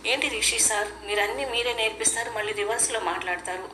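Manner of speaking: usual speaking voice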